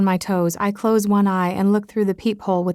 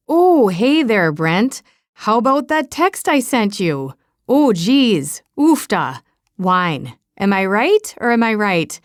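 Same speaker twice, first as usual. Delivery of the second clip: lightly hammed-up Minnesota accent that drifts Canadian; mock Minnesota accent; 3 dB louder than usual. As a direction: tease her friend in a jokey Minnesota accent. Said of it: "in her best Minnesota accent that sounds entirely too Canadian".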